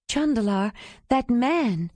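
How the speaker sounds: startled call to Jondalar. A narrator's reading. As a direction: blurt it out in alarm. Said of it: "caught the startled tone in her voice".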